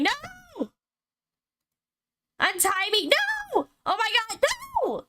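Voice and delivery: high panicked screams of no; terrified screaming. Acted. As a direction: scream in terror, pitch cracking up. Gregor tied up, being cut.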